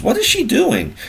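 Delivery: high, mock-puzzled squeak; incredulous quote. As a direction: toss it up high in disbelief. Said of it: "She goes".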